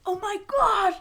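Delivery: hammed-up overwhelmed exclamation; retold emotional outburst. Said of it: "crying and then, like, happy".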